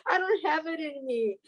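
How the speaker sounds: the desperate self of years ago, now in tears; crying. Real, not acted. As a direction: voice breaking into real tears. Recalling wanting to die.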